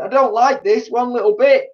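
high, loud frightened cry. A narrator's reading. Mister Twit answering his wife, high frightened cry. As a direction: cry out in agreement. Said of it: "cried Mister Twit".

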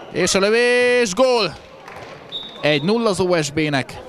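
high, loud, stretched goal shout; excited goal call. Real, not acted. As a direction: bellow the goal like a commentator. Goal scored in live commentary. (izgatott gólkiáltás)

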